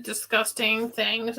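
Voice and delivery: usual speaking voice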